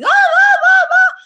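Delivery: very high, loud squealing ah; screechy high scream. Retold or imitated. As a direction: shriek it high like the old direction. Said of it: "higher, louder".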